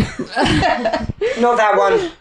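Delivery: very slow, high, laughing protest. Real, not acted. Host laughingly begs cohost not to repeat the accent, slow and high. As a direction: stretch it out through a laugh. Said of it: "With my accent".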